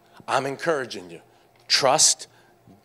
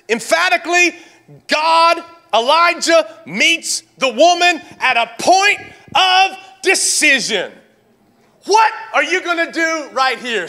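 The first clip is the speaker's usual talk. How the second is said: high, loud preacher's cry; emphatic preaching shout; 11 semitones higher, 5 dB louder than usual. Acted. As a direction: drive the point home at full force. Preaching at full force.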